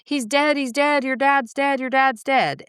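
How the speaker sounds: put-on grandma screaming the news at a boy home alone; hysterical screaming. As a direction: shriek it like a grandma losing it. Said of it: "she just lost her shit".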